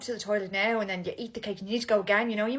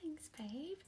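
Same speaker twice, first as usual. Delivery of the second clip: soft whispered babe; 3 semitones higher, 14 dB quieter than usual. Acted. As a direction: breathe the word. Whispered pet name at dinner.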